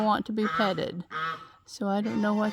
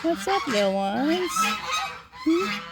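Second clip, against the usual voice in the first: high coo to chicks; 6 semitones higher than usual. Real, not acted. Owner greeting the baby chicks in a high coo. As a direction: lift into a sweet high coo.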